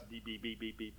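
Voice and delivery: soft, low string of imitated beeps; scanner beeps. Pastor mimicking the receipt check at Sam's, quiet scanner beeps.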